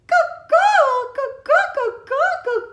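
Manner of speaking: high, sing-song bird call; mother hen call. Acted. Mother hen calling her babies back, in a high bird call. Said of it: "said Mommy".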